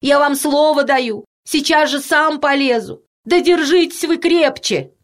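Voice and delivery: high, urgent pleading; pleading cry. A narrator's reading. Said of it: "умоляюще кричал человек с бледным лицом". (умоляюще, высоким голосом)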